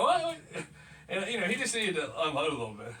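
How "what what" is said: high, loud, puzzled what; mock surprise. Retelling his reaction to Doug's call, surprised. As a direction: act startled by the news. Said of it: "I go".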